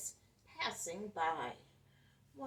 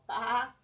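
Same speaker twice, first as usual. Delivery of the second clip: lamb bleat; 4 semitones higher, 8 dB louder than usual. Acted. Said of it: "calf called".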